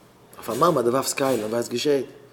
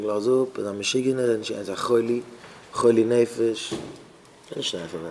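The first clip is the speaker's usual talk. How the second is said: usual speaking voice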